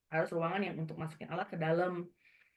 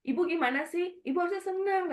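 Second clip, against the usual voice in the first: high-pitched, incredulous mock scolding; retold exasperated chiding; 12 semitones higher, 4 dB louder than usual. Doctor to bewildered pregnant patient, high retold chiding.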